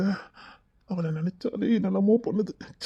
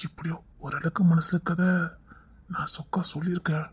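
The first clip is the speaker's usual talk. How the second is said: soft unvoiced confiding whisper; hushed private admission; 5 dB quieter than usual. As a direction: whisper it to yourself. Lovesick man muttering alone.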